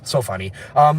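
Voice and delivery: ordinary talk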